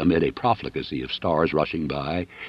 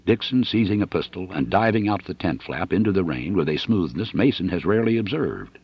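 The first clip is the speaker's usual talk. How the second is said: usual speaking voice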